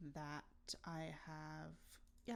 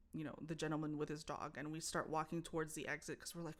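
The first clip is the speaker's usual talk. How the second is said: ordinary talk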